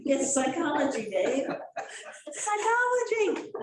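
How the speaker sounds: laughing through the words; giggling mock exasperation; laughing tease. Real, not acted. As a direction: tease him while laughing. Teasing husband, laughing.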